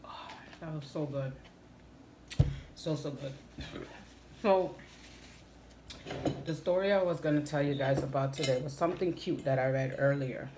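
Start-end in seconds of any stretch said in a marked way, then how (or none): 0.1-8.3 catching breath after drinking; just after a sip. Real, not acted. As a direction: mouth still wet. Seen 3: Drank from bottle, caps it, wipes mouth while speaking.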